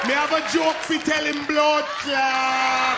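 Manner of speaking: slow, loud Jamaican patois threat; drawn-out Jamaican gunman calling for the comedian; Jamaican accent. Retold or imitated. Angry Jamaican man calls the comedian out at the door. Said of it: "he with the gun in his hand like".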